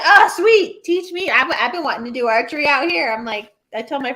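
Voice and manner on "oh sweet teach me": high, loud burst of enthusiasm; eager. Acted. Imagining a keen guest asking to learn archery, excited. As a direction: jump at the offer, pitched up.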